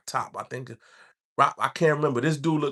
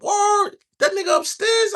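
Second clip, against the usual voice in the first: very high, louder retold surprise; excited disbelief; 5 dB louder than usual. Inmate learning his homie is upstairs, excited disbelief. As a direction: react amazed, voice shooting way up.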